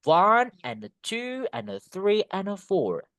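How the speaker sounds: slow lilting beats on "One", "two", "three", "four"; sing-song stress beat. Shown as a demonstration. Teacher repeating the model for students, slow sing-song beat. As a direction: drag out each number and tuck in "and a". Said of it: "You can say like that one".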